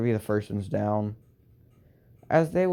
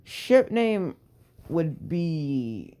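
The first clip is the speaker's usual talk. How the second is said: much higher, wavering up on ship name; nervous answer; 5 semitones higher, much slower than usual. Acted. Boy dodging the ship name question, pitched up nervously. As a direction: answer awkwardly, voice jumping up.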